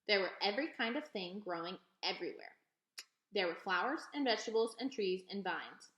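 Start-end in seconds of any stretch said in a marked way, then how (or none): none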